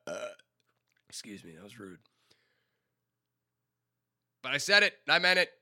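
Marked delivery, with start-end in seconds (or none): 4.4-5.5 loud, high, firm vow; bold declaration. Real, not acted. Doubling down to the viewers, loud and high. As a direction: stand by it, proudly. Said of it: "I'm gonna make a bold statement".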